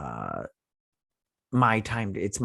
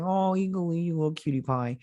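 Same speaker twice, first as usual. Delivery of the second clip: put-on high cooing baby talk; cooing at a cutie; 9 semitones higher than usual. Cooing at the eagle hugging him on screen, high baby talk. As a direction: coo like he's an adorable pet. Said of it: "And I was like".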